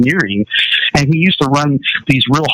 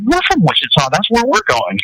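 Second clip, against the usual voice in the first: high, delighted exclamation; light up at the coincidence; young driver thrilled to meet a fellow Wichitan; excited surprise; 10 semitones higher than usual. Retold or imitated. Stranger finds they share hometown.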